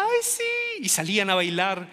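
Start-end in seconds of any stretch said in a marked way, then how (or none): 0.0-0.8 put-on high, gushing girly yes; mock swooning yes from a girl at the dance. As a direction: melt like a flattered schoolgirl. Girl accepting the jock.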